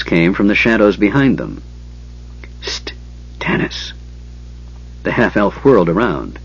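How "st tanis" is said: hushed whispered call. Acted. Flint whispering to Tanis from the shadows. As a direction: hiss his name from the shadows.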